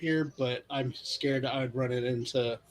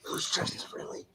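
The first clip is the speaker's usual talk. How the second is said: whispered question, kept very soft; hushed whisper; 8 dB quieter than usual. Pretend. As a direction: whisper into your hands. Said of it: "I'm trying to be quiet".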